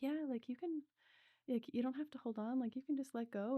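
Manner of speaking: lightly voiced caring facilitator, encouraging; gentle facilitator. Facilitator reassuring her to let go, lightly voiced gentle coaxing.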